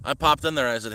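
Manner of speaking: usual speaking voice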